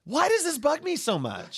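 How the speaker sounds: high-pitched self-questioning complaint; exasperated. Real, not acted. Co-host wondering why it bugs him, exasperated. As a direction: ask it high, at wit's end.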